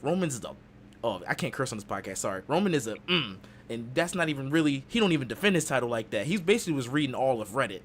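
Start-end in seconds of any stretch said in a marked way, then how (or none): none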